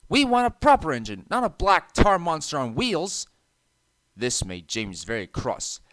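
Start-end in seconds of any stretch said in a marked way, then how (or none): 0.1-3.3 cheeky trucks. A narrator's reading. Rude trucks taunting James.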